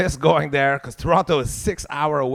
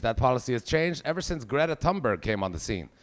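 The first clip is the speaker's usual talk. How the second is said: ordinary talk, nothing marked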